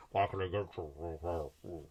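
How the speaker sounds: Lord Vader voice. Retold or imitated. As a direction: do the cashier as a deep, slow Darth Vader. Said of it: "she sounded like".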